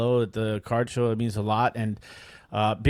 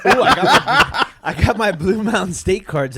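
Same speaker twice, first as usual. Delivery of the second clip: excited; 7 semitones higher, 7 dB louder than usual. Real, not acted. Excited about arriving cards.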